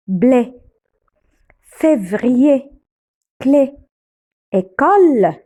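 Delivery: each word separate, with a closed "é" in blé, février, clé, école. Shown as a demonstration. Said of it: "on le prononce E, comme". (« é » bien fermé dans blé, février, clé, école)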